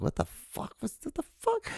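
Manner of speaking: squeaky high what the fuck; shocked as the memory returns; stunned disbelief. Retold or imitated. Recalling a returning childhood memory, high squeaky disbelief. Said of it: "I was like".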